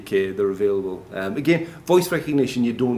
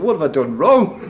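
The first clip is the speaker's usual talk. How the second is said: higher, louder, laughing dismay; mock aghast self-questioning; 7 semitones higher, 7 dB louder than usual. Speaker recalling the horrified lad in the audience, mock aghast. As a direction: exclaim it in comic bewilderment, breaking toward a laugh. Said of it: "I think".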